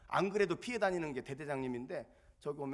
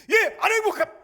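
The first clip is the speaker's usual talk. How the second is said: shouted soldier's answer; korean military style; 20 dB louder than usual. Retold or imitated. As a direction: yell it like a private at attention. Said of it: "대대장님이 물어보면 뭐라고 대답하겠습니까".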